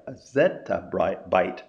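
"zettabyte" drawn out slowly and clearly; slow repeat. Shown as a demonstration. Lecturer slowly repeating "zettabyte" so students catch it. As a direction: spell out "zettabyte" by sound. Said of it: "Z E T T A, byte".

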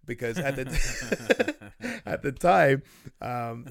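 slow words through laughter; laughing. Real, not acted. After the bank book punchline, laughing slowly.

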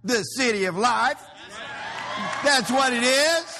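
high, loud rising proclamation; shouting to the crowd; preacher shout. Real, not acted. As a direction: belt it out to the congregation. Hyping the church to the crowd.

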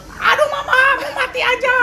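put-on high, loud child's wail. Boy being stitched wails to his mama. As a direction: cry out for mama like a hurting kid.

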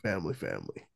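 deep, low gravelly rumble; Vin Diesel voice. Retold or imitated. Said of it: "Feeling a little like Vin Diesel".

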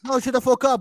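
ordinary talk

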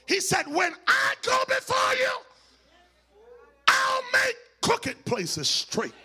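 climactic whooped sermon over the organ; full-throated gospel whoop; preaching at full force. Acted. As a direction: preach it out, shouting and near singing.

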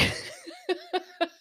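a quick amused laugh; high squeaky laugh. Real, not acted. Vlogger laughing at her own joke, high giggle.

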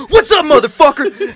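loud, high-pitched teasing imitation; mock confrontational shout. Co-host mocking the guys getting out, loud challenge. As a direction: holler it like someone starting a fight.

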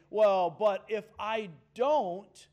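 a mock excuse pushed out loud; self-justifying whine. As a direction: talk yourself into it, loud and insistent. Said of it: "and I say".

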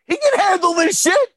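high, hyped-up vote of confidence; retold excited confidence. Acting out excited faith in Coach.

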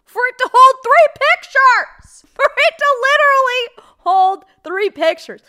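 shrieking high and loud, slowed; unhinged rant at the top of her voice; crazy voice. Acted. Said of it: "I'm getting into my crazy voice right now".

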